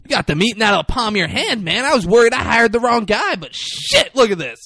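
high, loud, hammed-up amazement; gushing praise. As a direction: imitate a boss bowled over, voice way up. Acted boss gushing over trainer.

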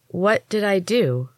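"What did I do?" drawn out slow, each word apart; slowly. A narrator's reading. Hesh asks the healers slowly what happened, slow and wary. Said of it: "I asked slowly".

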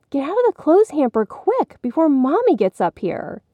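put-on high, urgent order in a girl's voice; bossy sister. A narrator's reading. Katie warning Billy in the hamper, urgent. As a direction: hurry him out before mother comes.

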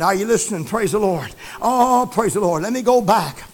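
normal talking voice

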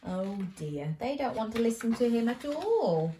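plain reading voice

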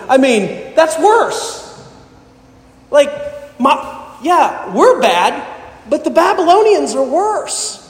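high, exasperated mock complaint; a prophet throwing up his hands at God, voice climbing; frustrated. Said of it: "in frustration".

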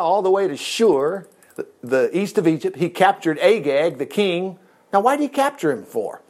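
usual speaking voice

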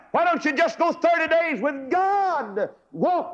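high, ringing exhortation; full-force preaching. Acted. Preacher urging the crowd to try God, high and ringing. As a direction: rouse the congregation.

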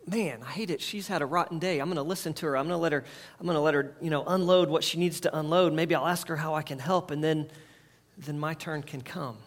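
soft, patient inner voice; gentle and caring. Retold or imitated. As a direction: ease into it like a kind husband.

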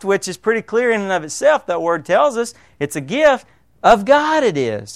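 ordinary talk

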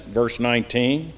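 ordinary delivery

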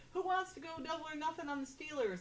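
retold yell. Betting man to the boisterous crowd, retold yell. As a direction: call it out high without real volume. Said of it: "yells out".

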